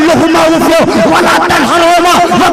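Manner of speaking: high, loud, impassioned sermon cry; fiery preaching. Acted. Preacher exhorting the congregation, impassioned loud sermon. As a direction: preach it at full force to the hall.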